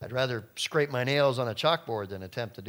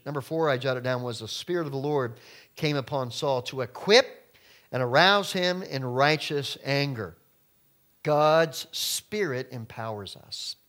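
usual speaking voice

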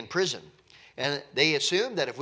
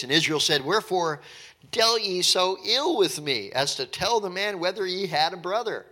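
raised, formal reading of the verse; scripture reading; 4 semitones higher, 5 dB louder than usual. Acted. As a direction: read the verse out with weight.

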